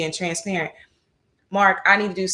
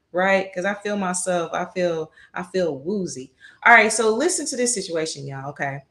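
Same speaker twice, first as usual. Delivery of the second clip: ordinary talk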